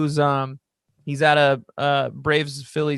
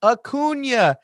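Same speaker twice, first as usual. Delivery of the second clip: higher, sing-song "Acuna" led like a choir; mock conductor teaching; 8 semitones higher, 3 dB louder than usual. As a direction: lead the crowd through the name. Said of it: "wave it like he's a conductor".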